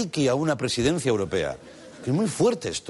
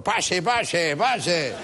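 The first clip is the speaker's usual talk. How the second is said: higher, insistent repeated invitation; impersonated elder politician ushering in; 4 semitones higher, much slower than usual. Retold or imitated. Comic as the old politician welcoming Pablo, higher-pitched ushering. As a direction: usher someone through, pitched up. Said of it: "y el otro".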